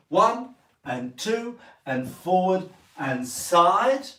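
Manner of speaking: slow, drawn-out count with the beat stressed; slow dance count. Shown as a demonstration. Dance teacher counting the boys through the step slowly. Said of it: "One more time for the boys".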